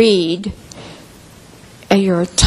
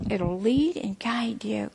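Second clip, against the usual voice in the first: soft whisper, slow; 12 dB quieter than usual. Real, not acted.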